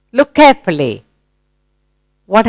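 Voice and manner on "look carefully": ordinary delivery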